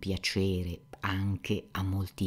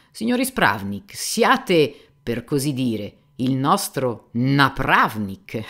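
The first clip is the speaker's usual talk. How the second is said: worldly nonchalance; 2 semitones higher, 9 dB louder, slower than usual. Retold or imitated. Fyodor retells greeting the police chief with a smug pun. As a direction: deliver the pun with smug ease. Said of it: "con quella disinvoltura da uomo di mondo".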